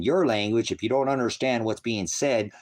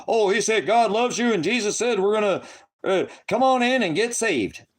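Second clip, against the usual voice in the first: high, sing-song mock preacher; hammed-up high preacher imitation; 10 semitones higher, 4 dB louder than usual. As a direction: parody a gushing church evangelist. Mocking a churchy preacher.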